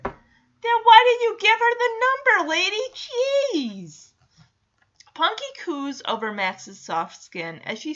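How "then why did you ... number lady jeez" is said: high, loud exasperated question; exasperated disbelief. Real, not acted. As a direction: throw up your hands in disbelief. Mocking the character's logic.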